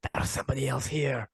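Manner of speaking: in-character remark. Acted. Gareth reacting to an overheard stranger, softly in character. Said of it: "Gareth hears this and goes".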